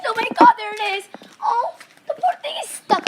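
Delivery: excited squeal. Real, not acted. Finding trapped bird, laughing.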